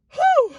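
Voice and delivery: sharp, high exhale to regain composure; high whew. Real, not acted. Pausing mid-confession to steady oneself, high whew. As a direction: blow out the tears on a high whew. Composing self while crying.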